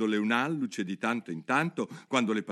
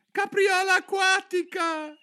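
very high, loud squeal; shouted cry; 6 dB louder, slower than usual. A narrator's reading. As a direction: shriek it in wild excitement, drawn out. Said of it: "gridò la tartaruga d'Egitto". (grido acutissimo e forte)

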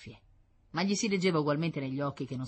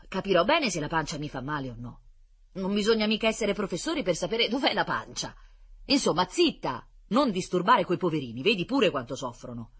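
scolding; 4 semitones higher, 6 dB louder than usual. Acted. Nurses hush the girl.

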